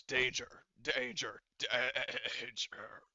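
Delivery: slow mechanical android warning; droning robotic alarm voice. Acted. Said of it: "mechanical voice".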